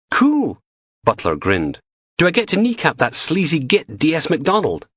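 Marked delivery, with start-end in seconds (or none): 0.1-0.6 gleeful cool. Acted. Butler thrilled by gangster idea. 2.2-4.9 a bright, keen woman's voice, lightly higher; eager young woman. Acted. Butler voiced as eager female.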